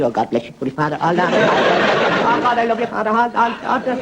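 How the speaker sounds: a touch of gushing, pitch climbing; lightly voiced Irish mother. Irish mother greeting the Holy Father, lightly acted gushing. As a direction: gush as the adoring parishioner. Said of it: "And she said".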